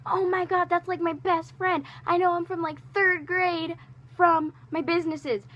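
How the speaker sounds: high, loud, thrilled gush; excited squeal. Retold or imitated. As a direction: gush like you just spotted your best friend. Retelling her joy at seeing friend.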